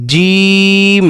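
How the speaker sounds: long, held জিম with a firm j; firm Arabic jim. Shown as a demonstration. Teacher shows learners the letter জিম, firm and drawn out. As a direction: draw out জিম on a level note, j pressed hard. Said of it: "জিমটা একটু শক্ত হবে".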